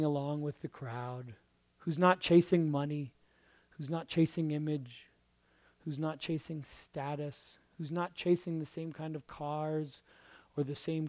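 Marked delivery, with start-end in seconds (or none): none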